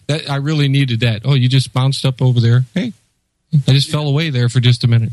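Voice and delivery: ordinary talk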